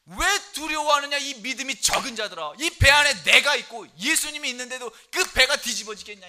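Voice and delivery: loud, rising rebuke of the disciples; Jesus rebuking the fearful disciples, loudly. Retold or imitated. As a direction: scold them hard for their fear. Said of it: "예수님이 꾸짖지 않습니까?".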